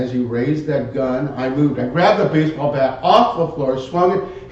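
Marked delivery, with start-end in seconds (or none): none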